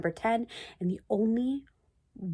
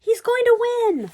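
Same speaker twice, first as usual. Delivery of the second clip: high, loud cheer; excited shout; 7 dB louder, slower than usual. A narrator's reading. Spectators cheering as he nears the finish, excited. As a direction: yell it with the crowd. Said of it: "shouted the people".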